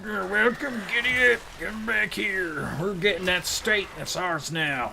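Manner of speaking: high-pitched cartoon Texan accent; memeable Southern accent. Texan taking that state, hammed-up Southern accent. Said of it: "southern accent".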